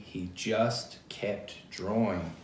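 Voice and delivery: put-on slow, deliberate refrain for kids to join. Shown as a demonstration. Reader inviting children to chant along, slow refrain. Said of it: "say it with me".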